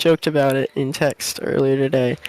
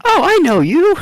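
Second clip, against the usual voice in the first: high startled recognition; lightly voiced surprise; character voice; 13 semitones higher, 4 dB louder than usual.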